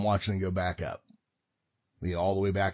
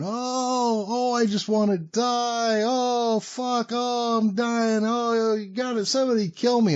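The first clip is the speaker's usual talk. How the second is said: high, drawn-out wail of a patient; hammed-up moaning, stretched slow and high; mock yelling; 13 semitones higher, slower than usual. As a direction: imitate a patient yelling in agony. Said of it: "I can hear him yelling".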